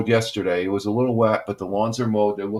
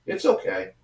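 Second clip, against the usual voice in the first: soothing the dog. Real, not acted.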